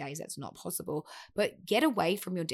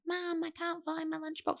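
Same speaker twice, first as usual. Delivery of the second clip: put-on high, small child's voice; a child calling for mum; kid voice; 11 semitones higher, 5 dB quieter than usual. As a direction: whine it like a little kid asking mum. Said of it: "I did the kid voice".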